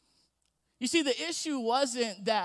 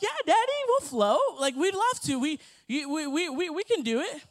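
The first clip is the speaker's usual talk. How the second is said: put-on higher, excited little-girl voice; eager little sisters; 6 semitones higher, 4 dB louder than usual. Sisters begging dad to float, eager and higher. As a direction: chirp it like eager little girls.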